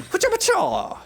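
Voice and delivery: high squeaky pacha pacha; cooking noise imitation. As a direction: mimic food squishing.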